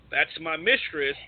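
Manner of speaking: usual speaking voice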